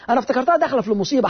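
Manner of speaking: ordinary talk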